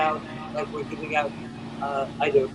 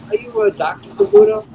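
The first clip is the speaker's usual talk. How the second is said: higher, louder mock mailman asking; imitating the mailman; 8 dB louder than usual. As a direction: voice the puzzled mailman, pitched up and loud.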